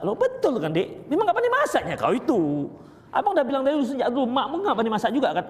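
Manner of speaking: chuckling through a tactless tease; laughing mock husband. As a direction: tease her, laughing as you go. (suami mengejek istri sambil tertawa)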